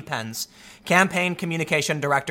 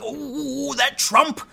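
high, hammed-up sour mutter of a sulking critic; mock a petty outraged opponent shaking a fist; mock grumbling critic; 8 semitones higher, slower than usual. Mocking outraged critics of Trump, high whiny grumble. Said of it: "would you rather be sitting there going".

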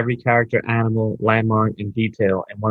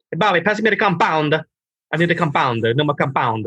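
higher-pitched yelling Italian coworker, imitated; heavy Italian accent; 6 semitones higher than usual. Plasterer calling to his coworker for materials, heavy Italian accent. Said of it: "really Italian Italian with a heavy accent".

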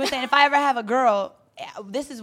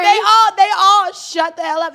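much higher and louder, gleeful; loud triumphant punchline; 9 semitones higher, 13 dB louder, slower than usual. Real, not acted. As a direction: belt out the payoff. Triumphant punchline of protester story.

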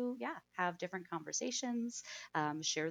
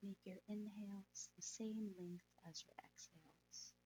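soft spa whisper; 8 dB quieter than usual. Acted. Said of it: "let's go ahead and meditate".

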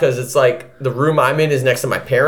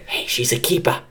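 sly whispered tip; whispered aside; 6 semitones lower, 6 dB quieter than usual. Retold or imitated. Grandma pulling him aside, whispering. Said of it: "and he's like".

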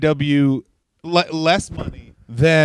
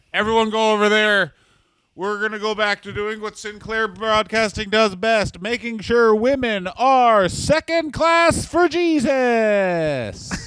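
raised, mocking preachy executive voice, slowing at the end; mock corporate boss; 6 semitones higher than usual. Cohost mocks Sinclair bosses announcing their plan, raised mocking voice. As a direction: sneer it like a pious TV exec.

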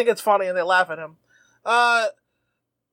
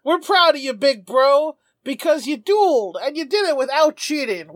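higher-pitched doting little brothers; earnest kid praising big brother, mock-sweet; Rover brothers; 4 semitones higher than usual. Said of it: "they tell Espa".

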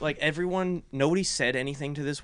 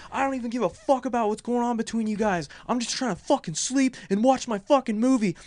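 furious yelling; 9 semitones higher than usual. Retold or imitated. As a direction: let her have it, voice pitched up. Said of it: "Just went off".

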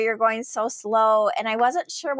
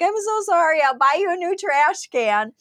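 high-pitched, pleading mock apology; imitating an over-apologetic driver; retold apology; 9 semitones higher than usual.

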